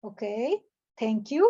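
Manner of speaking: calm acknowledgment. Acted. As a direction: defuse the anger calmly. Calm answer to acted angry rant.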